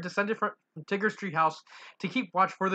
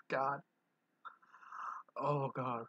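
usual speaking voice

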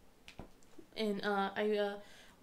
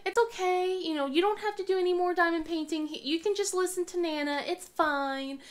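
higher, gentle comfort; soothing mom; 7 semitones higher than usual. Retold or imitated. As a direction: mom comforting sick son, higher and tender. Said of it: "I'm like".

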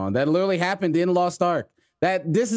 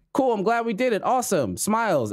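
higher, chirpy mock pleasantness; imitated cheerful teammate; 8 semitones higher than usual. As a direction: imitate the pleased random player. Imitates pleased random player.

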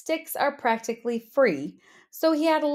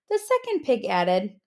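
ordinary delivery

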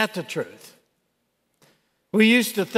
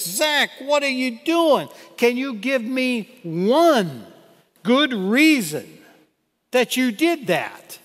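higher, urgent father's scolding question; startled father; 5 semitones higher than usual. Retold or imitated. Father to son who leapt at him, raised startled scolding. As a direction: demand an explanation, shaken and breathless.

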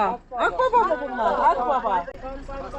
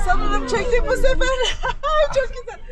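excited; 10 semitones higher than usual. Real, not acted. Excited whisper of catching shot.

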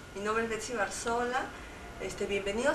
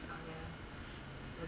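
ordinary delivery

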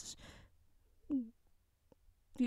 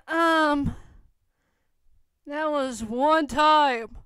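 throat clear, then a slow, louder correction; pointed correction; 4 semitones higher, 6 dB louder, much slower than usual. Acted. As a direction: lay each word down slowly and firmly.

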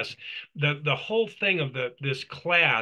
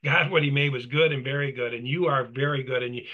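ordinary talk, nothing marked

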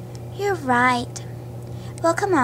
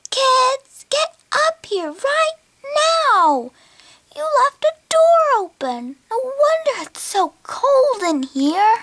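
angry yell; 9 semitones higher than usual. Acted. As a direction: call the kids up sharply, much higher. Mom scolding kids about door.

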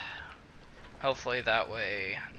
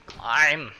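shouted command; 4 semitones higher, 6 dB louder than usual. Real, not acted. Yelling at character.

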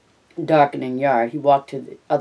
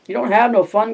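higher, mildly insistent push; 8 semitones higher, 4 dB louder than usual. A narrator's reading. Carlson pressing Candy about his old dog, mildly insistent. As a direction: press the point, pitch lifted. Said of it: "Carlson insisted".

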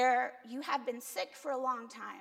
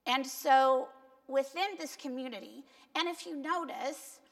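usual speaking voice

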